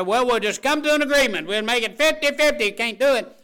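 hammed-up couple arguing; mock argument. Couple arguing over drinks.

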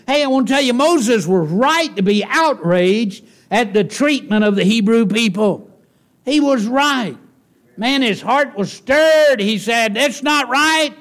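fervent preacher building to a climax; preaching at full force. Acted. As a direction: preach it hard and high to the congregation.

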